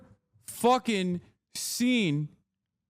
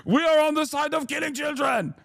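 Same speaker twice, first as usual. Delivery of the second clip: high, proud shout in a put-on accent; mock Israeli spokesman; 7 semitones higher, faster than usual. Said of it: "they're constantly like".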